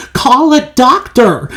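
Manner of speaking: panicked father shouting. A narrator's reading. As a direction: call for help urgently. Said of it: "shouted Mister Beauregard".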